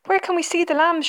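put-on high, eager girls' question; mild shriek. A narrator's reading. Visiting girls asking to see the lambs, mildly shrieking. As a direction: pipe up excitedly, short of a real shriek. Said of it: "shrieked the two girls".

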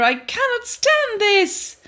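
high and slow, worn out; Mister Bear sick of the noise; exasperated Mister Bear. Acted. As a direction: drag it out in despair. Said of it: "said Mister Bear".

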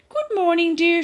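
high bright greeting; cheerful Missus Bear. Acted. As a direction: sing good morning to her husband. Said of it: "she said".

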